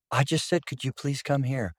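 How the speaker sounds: softly, with no weight on "please"; innocent understatement. Retold or imitated. Counseling client excusing their words, mild and innocent. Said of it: "someone says".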